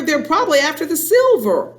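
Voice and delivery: high, agitated warning; alarmed wife pressing her point; urgent hissing. A narrator's reading. Mother fretting to father about burglars, urgent hissing. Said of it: "hissed the mother".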